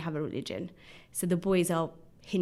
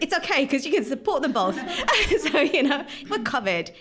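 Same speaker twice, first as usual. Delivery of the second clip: higher and louder, laughing through it; laughing joke; 8 semitones higher, 5 dB louder than usual. Real, not acted. Joking to her boys about the cricket, laughing. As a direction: crack up as you tease.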